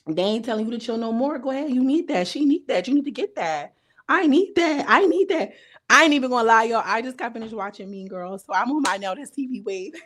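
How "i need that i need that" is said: much higher, louder cheer for the fight; excited high cheer; hyped up. Real, not acted. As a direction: egg them on, all hyped.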